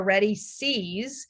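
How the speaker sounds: slow, carefully spaced words; slow test-word reading. Shown as a demonstration. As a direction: read each word slowly and clearly. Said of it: "you can see the example there".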